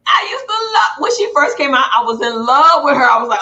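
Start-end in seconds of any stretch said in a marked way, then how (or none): 0.1-1.9 excited burst. Real, not acted. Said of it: "gushing about first seeing Jocelyn".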